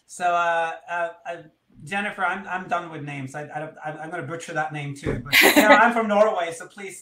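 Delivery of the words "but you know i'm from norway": says it through a laugh, a bit louder. Real, not acted. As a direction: laugh it off, embarrassed. Self-deprecating about her name pronunciation.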